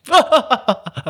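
high demonstration laugh, like a villain's; put-on villainous laugh. Shown as a demonstration. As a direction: laugh like a scoundrel for show. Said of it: "悪党みたいな笑い方だったけど".

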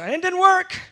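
squeaky whine, high and loud; mock whine of dismay. Deputy whining that the button failed, mock. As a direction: whimper it like a let-down kid.

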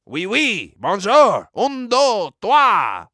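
put-on French accent, swooping up loudly; mock French accent. Comic showing off languages, in a hammed-up French accent. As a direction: count off in a showy French accent. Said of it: "starting to sound more French".

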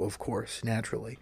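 ordinary talk

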